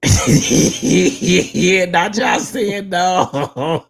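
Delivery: laughing through the words. Real, not acted. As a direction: breaks into laughter mid-sentence. Laughing at the chat's replies.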